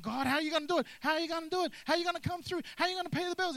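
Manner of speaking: hammed-up anxious believer praying; mock panicked prayer. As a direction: fret at God like you're running around. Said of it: "it's easy to run around".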